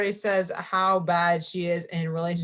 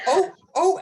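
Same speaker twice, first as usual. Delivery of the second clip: laughing cry; 11 semitones higher, 6 dB louder than usual. Real, not acted. Reacting to the Meredith line.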